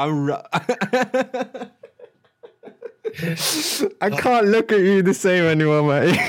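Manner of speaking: teasing while cracking up; trying not to laugh. Real, not acted. Teasing friend over Love Island.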